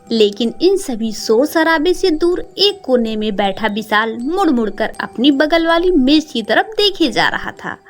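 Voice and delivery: ordinary delivery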